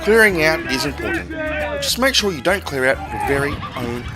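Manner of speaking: ordinary talk, nothing marked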